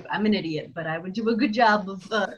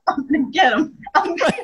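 laughing mock threat; 5 semitones higher, 5 dB louder than usual. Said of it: "You're like".

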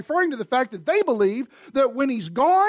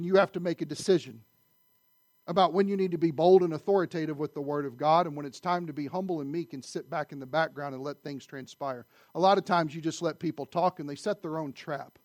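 usual speaking voice

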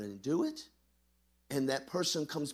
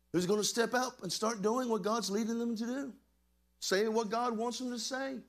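high-pitched preaching, building in urgency; rally the congregation, voice riding high; fervent preaching; 6 semitones higher than usual. Real, not acted. Preaches with rising force.

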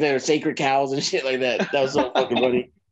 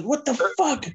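pissed-off boss; 8 semitones higher, much slower than usual. Retold or imitated. Angry man waking hungover wrestlers, imitated outrage. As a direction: imitate an angry wake-up, pitched up. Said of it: "He was so pissed off at us".

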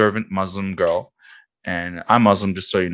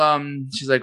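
ordinary delivery

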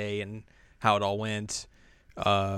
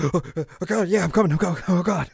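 high, loud mock fluster; flustered panic; 8 semitones higher, 6 dB louder, faster than usual. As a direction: caught off guard and scrambling to answer. Sister caught off guard, flustered.

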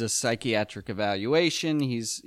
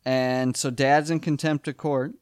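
ordinary talk

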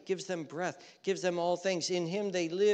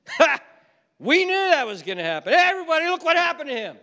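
loud, high crowing taunt; mock gloating; 13 semitones higher, 11 dB louder, faster than usual. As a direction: crow to everyone, hammed up. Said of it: "We want things to go bad".

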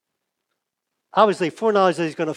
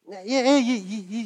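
higher-pitched, stuttering onlooker; stammering accuser; 6 semitones higher than usual. Acted. Second bystander points at Peter, stammering accusation. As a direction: stumble over it as you single someone out.

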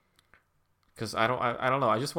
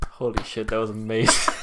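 ordinary talk, nothing marked

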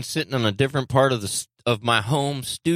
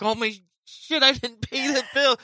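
mock panic; 12 semitones higher than usual. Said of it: "I was like".

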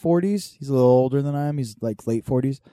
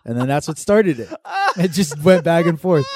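ordinary delivery

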